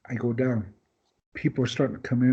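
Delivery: normal talking voice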